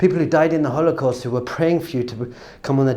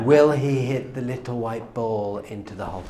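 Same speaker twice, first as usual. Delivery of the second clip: low, soft commentator hush; hushed, tense golf commentary; hushed suspense; 4 semitones lower, 7 dB quieter than usual. Acted. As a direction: murmur it as the silent crowd waits. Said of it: "There's silence".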